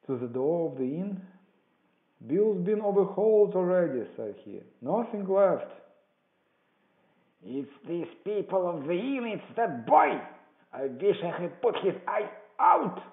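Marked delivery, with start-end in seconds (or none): 2.3-4.1 high, loud report; calling out. A narrator's reading. Man at inn door reporting to the beggar, loud shout. 4.8-5.7 high, loud finish to a report; calling out. A narrator's reading. Man at inn door reporting to the beggar, loud shout. 7.4-13.0 high, loud outburst; Pew's angry cry. A narrator's reading. Blind beggar blaming the boy, furious shout. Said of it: "cried the blind man Pew".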